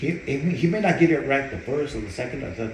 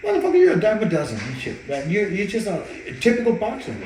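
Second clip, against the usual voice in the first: high, loud scolding; mock yell; 9 semitones higher, 4 dB louder than usual. Trainer scolding his cocky brother, high loud yell. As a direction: cut him down to size.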